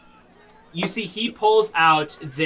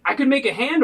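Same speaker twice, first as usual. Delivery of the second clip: smith offering, lifted; 9 semitones higher, 3 dB louder than usual. Acted. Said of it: "He's like".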